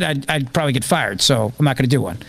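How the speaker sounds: ordinary talk, nothing marked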